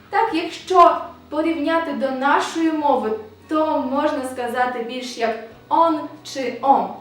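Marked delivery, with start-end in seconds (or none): none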